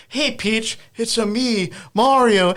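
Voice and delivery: Chris Pratt. Acted. Chris Pratt walking up to Peach as Mario, lightly imitated. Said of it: "Chris Pratt's going up to Peach".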